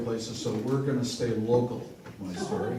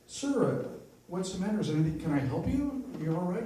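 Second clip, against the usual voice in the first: lightly voiced concerned woman; 7 semitones higher than usual. Woman asks sobbing old man.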